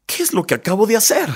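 high, fast question to yourself; re-enacted "what have I done" moment; retold self-shock. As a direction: act out sudden dismay at what you did. Retells his shock at himself.

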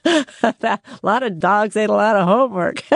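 real chuckle running through the words; laughing. Real, not acted. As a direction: crack yourself up on your own joke. Host joking about students' excuses.

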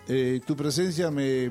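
ordinary delivery

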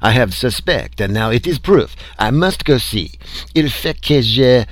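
put-on urgent French accent, rising in intensity; excited French accent. A narrator's reading. French scientist insisting he must go see, French accent.

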